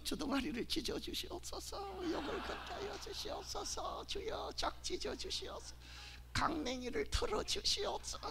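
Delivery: hushed, pious-sounding curse prayer; mock prayer. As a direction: pray sweetly while cursing.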